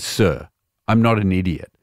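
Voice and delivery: low-pitched imitation of an unconvinced barman; lightly voiced barman, low and firm; retold stern barman. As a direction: drop the voice to rebuff a denial. Retold barman rebuffing the denial.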